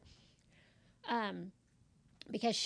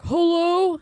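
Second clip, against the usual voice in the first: imitated loud, higher-pitched hello; loud phone hello; 7 semitones higher, 14 dB louder than usual. Man answering a call from the office, loud hello. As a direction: boom out a mock phone greeting.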